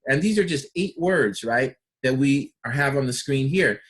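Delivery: ordinary talk, nothing marked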